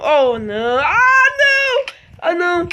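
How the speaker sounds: high frantic wails of panic; panicked crying out. Real, not acted. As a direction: wail in panic as he's attacked. Crying out under attack.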